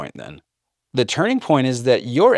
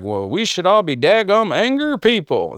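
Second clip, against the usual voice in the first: mock zealot; 7 semitones higher than usual. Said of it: "Before you're like".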